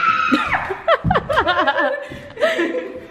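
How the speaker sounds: shrieking laugh. Real, not acted. Reacting to room.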